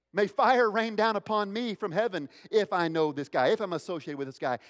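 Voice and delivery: loud, high, vehement oath; calling down curses. Acted. Peter cursing himself to deny Jesus, vehement oath. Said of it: "calling down curses upon himself".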